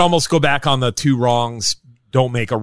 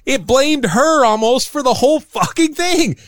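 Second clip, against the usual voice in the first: exasperated rant; 11 semitones higher than usual. Real, not acted.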